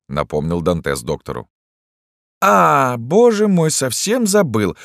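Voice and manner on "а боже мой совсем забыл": high exclamation, slowing at the end; sudden realisation. A narrator's reading. Doctor reminded of January first, suddenly realising. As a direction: cry out high as it dawns.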